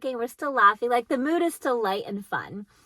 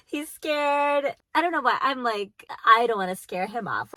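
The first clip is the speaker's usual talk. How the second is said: usual speaking voice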